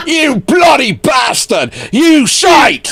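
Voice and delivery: hammed-up northern yell; mock northern shout. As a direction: bellow it like a hammed-up northern hard man. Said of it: "so do a northern slang".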